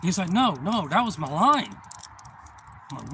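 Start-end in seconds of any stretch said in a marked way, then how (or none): 0.3-1.8 higher, louder, eager protest; a kid blurting out that the catch was his; excited kid. Retold or imitated. Kid protests about his line.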